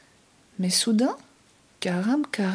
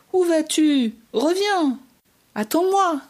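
high calls after someone running away; calling after; 7 semitones higher than usual. Acted. Chasing the runaway goat across the dunes, high calling. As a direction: call out higher across the dunes.

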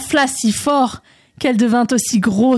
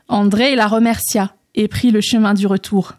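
plain reading voice

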